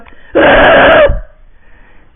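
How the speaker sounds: high, very loud rasped scream. Shown as a demonstration. Demoing a chest scream for viewers. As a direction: let the scream rip from the chest. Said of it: "So you go".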